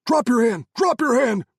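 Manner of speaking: high, loud repeated shout; shouted command. A narrator's reading. Soldiers ordering Hamid to drop his hand, loud shouts. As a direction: yell it, rifles up. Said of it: "Carl's men shouted in unison".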